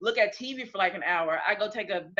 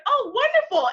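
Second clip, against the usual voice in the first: mock delight; 5 dB louder than usual. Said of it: "you're like".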